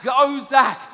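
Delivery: loud, high sideline cheering; dad shouting encouragement to his son on the field, loud; yelling from the sideline. Retold or imitated. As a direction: yell like a proud parent at junior footy. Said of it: "I'm yelling out".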